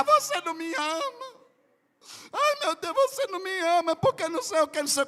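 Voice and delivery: high, whiny fake sob voice; imitating a wife crying to get her way; mock crying wife. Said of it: "ela começou a chorar". (imitando a esposa chorando, voz aguda e chorosa)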